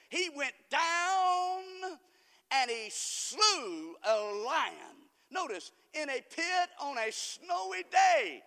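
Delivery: very slow, high, stretched out; slow loud preaching. Acted. Preacher dramatizing the pit to the congregation, slow and loud. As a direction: draw out every word for the church.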